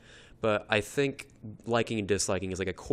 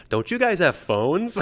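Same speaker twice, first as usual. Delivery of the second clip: higher, slow retold stage question; mock panicked host; 7 semitones higher, 4 dB louder than usual. Panicking presenter on stage to hostile audience, imitated panic. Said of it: "internally panicking".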